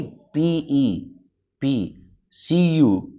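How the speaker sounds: slow letter-by-letter spelling. Shown as a demonstration. Teacher spelling the word letter by letter. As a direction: spell it slowly for the class. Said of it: "तो Indian accent में पे".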